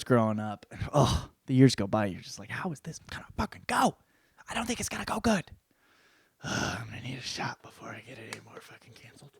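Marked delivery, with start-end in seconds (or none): 2.5-5.4 high, strained near-whisper; squeaky, airy worry; mock dread. Dreading what happens next in story. 6.4-9.3 very soft, breathy groan; hushed, worn-out whisper; groaning aside. Acted. Groaning aside about needing a drink.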